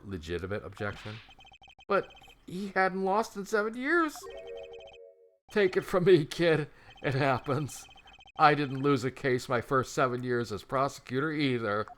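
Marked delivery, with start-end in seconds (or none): 1.9-11.9 prosecutor voice. Acted.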